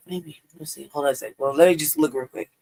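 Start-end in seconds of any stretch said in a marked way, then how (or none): none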